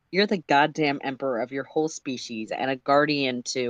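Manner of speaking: ordinary delivery, nothing marked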